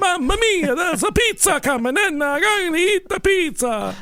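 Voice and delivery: high cartoon Italian accent; jolly italian pizza chef.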